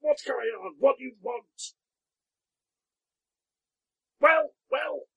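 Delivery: a hushed shout pitched high; the farmer half-yells in a whisper at the visitors; shouting while whispering. Acted. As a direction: yell under your breath. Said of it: "he's shouting at you while whispering".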